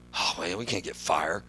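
very low, soft mock complaint; defeated false prophets. False prophets failing to light the altar, mock-defeated. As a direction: mumble it low, as if giving up.

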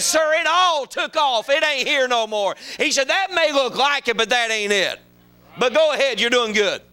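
high, emphatic retold correction; insistent pastor. Visiting pastor correcting the tour guide, insistent. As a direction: correct him firmly with pitch lifted.

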